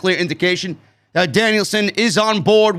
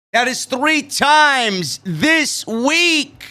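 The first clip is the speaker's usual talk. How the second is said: slow, higher-pitched disbelief; amazed; 5 semitones higher, much slower than usual. Real, not acted. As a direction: stretch each phrase out in amazement at the donation. Marvels at repeated big donation.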